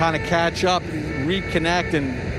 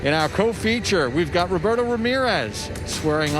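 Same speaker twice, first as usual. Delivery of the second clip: higher, upbeat broadcast opener; sports announcer; 4 semitones higher than usual. Acted. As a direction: welcome viewers to the big show. Broadcast open by host.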